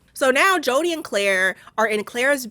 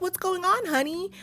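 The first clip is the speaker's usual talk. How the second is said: much higher, sugary imitation of Mary; sweet Mary; 10 semitones higher, 4 dB quieter than usual. Imitating Mary greeting Claire, higher and sugary. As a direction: coo it like an oblivious sweet wife. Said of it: "it's like".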